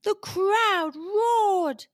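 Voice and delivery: high, slowly stretched narration; drawn-out excitement. A narrator's reading. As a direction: stretch it out to evoke cheering. Narrator relishes the crowd's roar.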